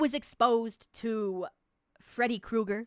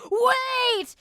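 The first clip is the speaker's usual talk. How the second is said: excited; 12 semitones higher, 6 dB louder than usual. Retold or imitated. Reading chat, high loud.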